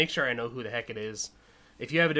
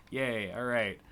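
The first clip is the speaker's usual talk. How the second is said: usual speaking voice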